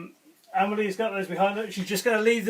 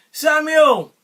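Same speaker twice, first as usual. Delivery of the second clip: high, loud, drawn-out calling; group call-out yell; 8 semitones higher, 13 dB louder, slower than usual. A narrator's reading. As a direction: call the name, then yell the wake-up cry. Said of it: "we're all gonna yell together".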